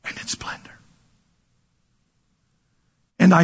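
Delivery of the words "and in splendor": whispered, barely audible; hushed. Acted. Preacher whispering the reading's last words to the church. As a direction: breathe the words to the congregation.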